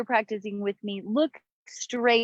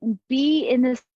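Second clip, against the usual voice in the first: guided meditation. Acted. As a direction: guide them into the breath, unhurried. Leads breathing meditation over music.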